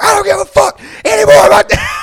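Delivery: high, loud mock shout; angry outburst. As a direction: yell it as the fed-up friend. Retold angry friend cutting him off.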